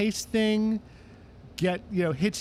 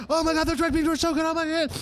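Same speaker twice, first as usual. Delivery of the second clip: ecstatic [name]; 11 semitones higher, faster than usual. Retold or imitated. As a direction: rave about the beans like Ray. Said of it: "he's just going".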